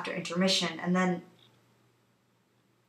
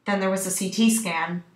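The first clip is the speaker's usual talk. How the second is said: usual speaking voice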